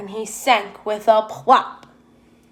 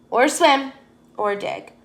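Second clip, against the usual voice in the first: ordinary delivery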